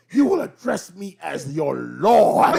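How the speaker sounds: loud, slow, laughing decree; commanding king. Retold or imitated. A king laughing as he orders his brother to bow. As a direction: announce it like a haughty lord. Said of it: "he come in and said".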